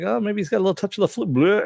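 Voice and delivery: goofy character voice. Retold or imitated. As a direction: rattle it off in a silly high cartoon voice. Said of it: "he's like".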